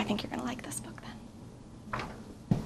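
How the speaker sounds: soft secret whisper; whispered hint to the kids. Acted. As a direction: lean in and whisper it. Reader confides to children.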